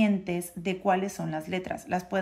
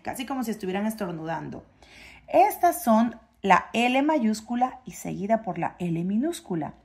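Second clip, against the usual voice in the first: ordinary talk, nothing marked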